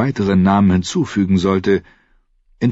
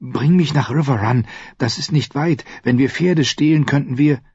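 put-on high, hopeful girl voice; pleading young girl; eager girl voice; 8 semitones higher than usual. Arya eager plea.

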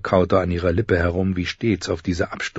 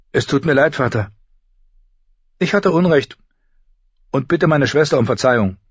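put-on high girl's voice, haltingly apologising; apologetic girl; 7 semitones higher, 4 dB louder than usual. Arya apologises to father and sister, contrite. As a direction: apologise in short, separated phrases.

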